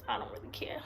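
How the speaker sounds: breathy whisper, no voice at all; whispered aside. Real, not acted. Telling viewers she doesn't care, in a whisper. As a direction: whisper it off to the side, not caring.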